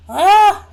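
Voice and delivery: high, loud mock scream; sudden yelp. Host jokingly screaming at the spooky line, loud yelp. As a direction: yelp it out as a joke fright.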